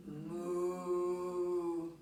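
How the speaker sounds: low playful moo; cow moo. Pretend. Teacher mooing for the cow pose, low and playful.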